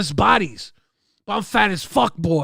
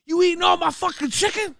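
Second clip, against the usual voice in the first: 1 indignant accusation; 10 semitones higher than usual. Retold or imitated. Accusing the gym bros.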